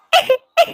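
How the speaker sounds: a sudden high sob without words; high wordless sob. Acted. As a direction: break into a sob. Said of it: "ऐसे रो मत".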